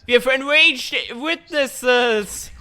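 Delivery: high, loud, slow announcement; mock astonished proclamation; fake surprise. Sarcastic reaction to chat.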